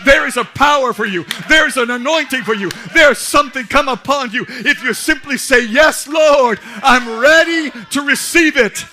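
loud, high, driving sermon; fiery preaching. Acted. Pastor to congregation, fiery preaching. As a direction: lift the congregation up.

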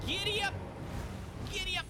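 excited giddy up. Real, not acted. Riding horse Torrent.

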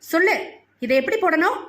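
understated angry bullying tone; mild scolding. A narrator's reading. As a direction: press the child, question rising sharply. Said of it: "என்று மறுபடியும் அரட்ட".

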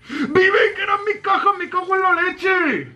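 very high, wailing gamer rage; squealing angry cursing; outraged shriek. Real, not acted. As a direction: scream in frustration over stolen loot. Rages over lost boxes.

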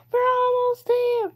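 high, loud, stretched-out cry; excited cheer. Real, not acted. Player nearing the end of the level, excited cheer.

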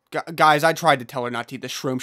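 usual speaking voice

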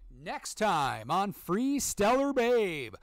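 mock episode announcer. Streamer teasing viewers about the next episode, mock announcer. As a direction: play up a cheesy next-time-on tease.

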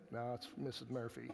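low, softly mumbled sheepish reply; mock embarrassment. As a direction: admit it quietly, as if embarrassed. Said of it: "it was kind of embarrassing".

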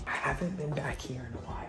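soft sneaking whisper. Real, not acted. Sneaking to the secret tunnel, whispering to viewers. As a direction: whisper as if someone could hear.

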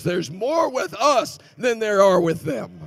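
slow, loud, high declaration; full-force preacher climax; preaching shout. Acted. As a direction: proclaim it slowly to the rafters.

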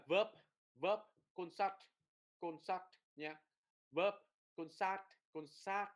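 short vowels in "verb" and "concert", "cert" as "sat"; âm ngắn lại. Shown as a demonstration. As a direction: cut each word short and crisp. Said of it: "đọc cái âm đó nó ngắn lại". ("verb, concert" đọc ngắn gọn)

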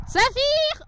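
high call across the field, "Saphir!". Real, not acted. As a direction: shout "Saphir!" to a far horse. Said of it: "je vais crier".

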